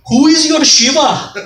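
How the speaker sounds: put-on high-pitched, loud Indian accent. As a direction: call it out in a hammed-up Indian accent. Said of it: "and in an Indian accent".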